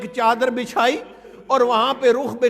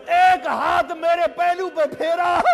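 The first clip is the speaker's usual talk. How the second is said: normal talking voice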